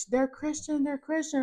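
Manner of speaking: husband excited about the sellers. Retold or imitated. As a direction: blurt it out twice in delight. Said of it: "he's just so excited".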